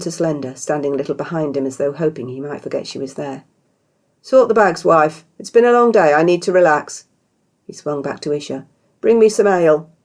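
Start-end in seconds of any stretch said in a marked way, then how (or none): 4.2-7.1 louder, higher commanding husband; bossy husband. Acted. Beardy ordering his wife. 9.0-9.9 louder, higher commanding husband; bossy husband. Acted. Beardy ordering ale.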